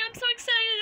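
bright high burst of excitement; squealing with excitement; excited. Acted. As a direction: gush with excitement. Kid voicing excited toy character.

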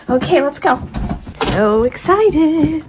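high, loud and thrilled; excited parent. Real, not acted. Parent setting off with child for a train ride, excited.

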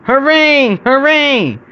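high, drawn-out hooray; jubilant cheer. A narrator's reading. Bertie sees Edward stopped at a crossing, cheering. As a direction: cheer at full pitch.

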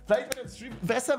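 ordinary talk, nothing marked

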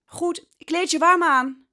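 high, loud motherly reply; mother bundling up her child; caring mother. Acted. Mother answering her child, loud and high.

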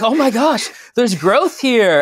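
high, slow gasp of wonder; mock amazement. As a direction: gush over the surprise, drawn out. Mock reader amazed at character growth.

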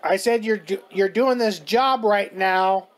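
normal talking voice

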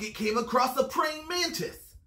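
ordinary delivery, nothing marked

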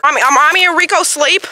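Mommy punched each time; stress on Mommy. Real, not acted. Asking on arriving home, Mommy stressed. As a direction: hit the word Mommy. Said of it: "Emphasis on Mommy".

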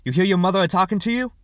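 rapid shouted reprimand; scolding shout. Acted. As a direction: snap at the boy to answer his mother. Neighbor scolds boy to answer.

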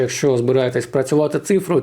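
ordinary delivery

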